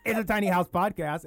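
talking through a choke; swallowed wrong way, still talking; choking on spit. Real, not acted. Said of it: "and I'm choking on my spit".

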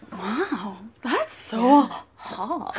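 low, soft, very slow amazement; drawn-out hushed swoon; smitten awe. Acted. Woman recalling meeting her accented date, smitten awe. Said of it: "and I was like".

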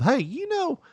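very high chatty mother voice; mom's voice. Retold or imitated. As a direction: nag like a chatty old mom. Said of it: "my mom always starts off with".

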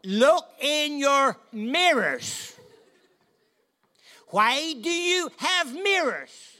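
high, slow shouted rant at a slow driver; ranting at the car ahead. Retold or imitated. Preacher behind a slow farmer, yelling through closed windows. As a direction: bellow it high and drawn out at the windscreen. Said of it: "And I'm saying".

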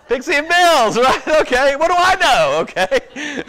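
high and loud through laughter; giggling it out; flustered laughing correction; laughing at own slip. Real, not acted.

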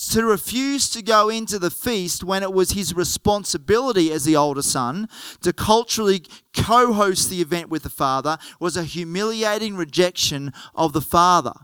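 ordinary talk, nothing marked

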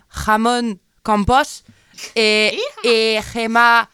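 put-on slow Spanish accent on the creators' names, breaking into laughter. Introducing the series creators to listeners, in a laughing Spanish accent. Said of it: "pardon, hein, pour l'accent pourri".

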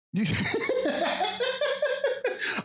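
real burst of high laughter; big laugh. Real, not acted. Host bursting out laughing at the co-host's crook voice. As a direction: crack up at your friend's bit.

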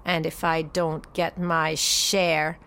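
low, slow snarled threat; snarling. Acted. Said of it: "he snarled".

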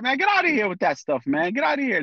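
high, scoffing dismissal from a buddy; mocking friend. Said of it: "some of them are like".

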